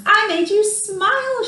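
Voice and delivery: crowing. Acted. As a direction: crow it in delight. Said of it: "she crowed".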